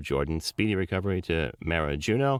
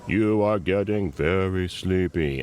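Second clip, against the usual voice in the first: hypnotist voice; 4 dB louder, much slower than usual. Retold or imitated. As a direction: lull them like a stage hypnotist. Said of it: "booked a job as a hypnotist".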